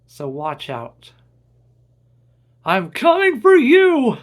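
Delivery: furious shout building to a scream; revenge vow. Acted. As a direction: call out the villain, build to a roar. Vowing revenge on villain.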